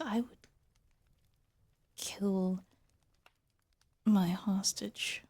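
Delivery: usual speaking voice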